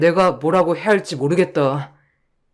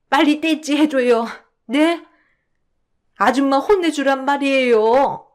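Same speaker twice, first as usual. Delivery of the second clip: put-on higher, whiny little-girl complaint; childlike tattling; 5 semitones higher than usual. Mother telling her son the caregiver yelled at her, whiny child. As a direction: tattle like a child who wants the grown-up to scold. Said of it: "어린아이처럼 말이에요".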